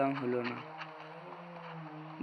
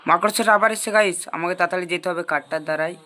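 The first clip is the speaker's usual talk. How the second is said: urgent alarm; 3 semitones higher, 7 dB louder, faster than usual. Real, not acted. Spider returns, must hurry.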